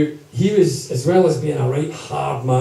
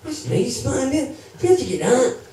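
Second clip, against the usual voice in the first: voicing the impressed chain boy; 11 semitones higher, slower than usual. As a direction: gush over the gift like a naive lad. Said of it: "The boy's like".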